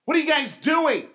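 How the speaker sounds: high, loud indignant yell out the car window; old woman yelling at the cocky young guys who took her spot; angry shout. Retold or imitated. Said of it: "she puts down her window and she says".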